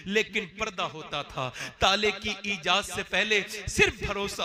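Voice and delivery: loud impassioned preaching; preaching at full force. Acted. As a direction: drive the lesson home to the crowd. Preacher raises voice to crowd.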